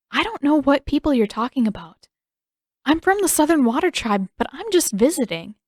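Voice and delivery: lightly voiced girl, uneasy; nervous girl protesting innocence; young girl voice.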